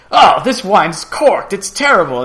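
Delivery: a puffed-up diner sending back the wine, slow and loud; pompous customer complaining about the wine, boisterous; boisterous know-it-all. Retold or imitated. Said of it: "boisterous and very full of himself".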